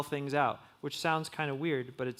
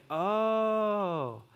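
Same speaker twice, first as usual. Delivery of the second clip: surprised realization; 5 semitones higher than usual. Retold or imitated. Sudden realization in inner monologue.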